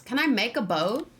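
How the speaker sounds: ordinary talk, nothing marked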